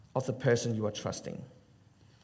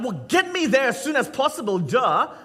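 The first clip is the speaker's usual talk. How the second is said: impatient; 10 semitones higher, 6 dB louder than usual. Retold or imitated. Impatient taxi passenger retold.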